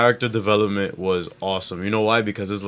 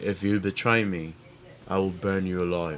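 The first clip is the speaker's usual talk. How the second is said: Daenerys Targaryen voice; 5 semitones lower, 9 dB quieter than usual. Retold or imitated. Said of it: "That's a great, great Daenerys Targaryen, uh, impression".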